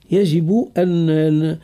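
ordinary delivery

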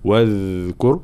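"واذكر" with a held, buzzing th as in "this"; voiced soft dhal. Shown as a demonstration. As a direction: let the ذ of "واذكر" flow, fully voiced. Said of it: "وصفة الجهر".

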